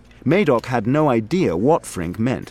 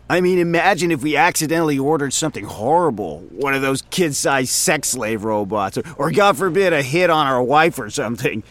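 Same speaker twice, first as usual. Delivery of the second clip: coworker voice climbing higher as he gets excited; rambling coworker voice working himself up; Frink, getting carried away; 6 semitones higher than usual. Acted. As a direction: spiral into absurd what-ifs, higher and higher. Frink voice, rising.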